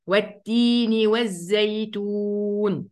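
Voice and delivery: والتين والزيتون drawn out slowly, chanted; slow recitation. Shown as a demonstration. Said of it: "يلا غيره".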